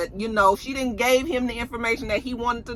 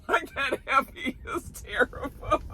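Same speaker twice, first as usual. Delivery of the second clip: laughing; 7 semitones higher, 6 dB quieter than usual. Real, not acted. Laughing at Effie.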